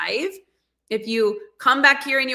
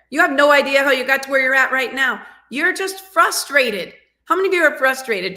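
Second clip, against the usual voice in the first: louder and higher, fast and fired up; heated frustration building to a shouted question; frustrated outburst; 5 semitones higher, 6 dB louder than usual. Acted. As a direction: rouse the audience like a fired-up coach. Fired-up coach calls out frustration.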